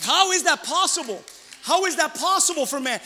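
loud, high rhetorical questioning; impassioned preaching. Real, not acted. Preacher asks the congregation how it's possible, impassioned. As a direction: throw the question out to the room.

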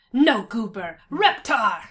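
loud toddler demand in a put-on voice; imitated baby. Imitating a baby demanding cereal, loud put-on toddler voice. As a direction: bark it like a baby with few words. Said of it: "actual babies would just be like".

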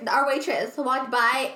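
normal talking voice

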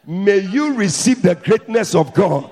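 loud, slow blessing; preacher's slow shouted blessing. Real, not acted. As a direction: call it out slowly.

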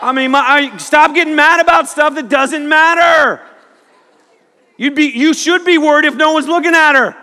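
high, loud comic shouting; exasperated rant. Acted. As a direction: shout it like a fed-up preacher on a rant. Comic rant at angry audience.

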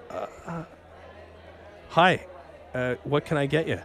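halting, tongue-tied greeting with long gaps; starstruck stammer. Retold or imitated. Starstruck bartender greeting a famous customer, flustered. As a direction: stumble softly into an awkward hello. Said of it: "just the stupidest stuff came out of me".

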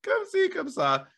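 very high, slow, drawn-out mimicry; teasing falsetto teacher imitation; imitating French teacher. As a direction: stretch out the teacher's catchphrase way up high. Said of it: "She would always be like".

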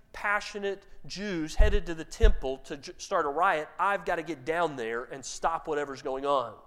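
ordinary talk

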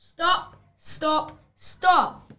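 shouted stop. Acted. Wolf shouts to stop the chicken story, loud. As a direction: bellow to halt the story.

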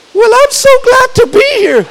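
high mocking voice of the muscleman. Preacher mocking Mister America's dainty greeting. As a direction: imitate the strongman's dainty hello.

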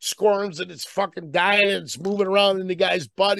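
ordinary talk, nothing marked

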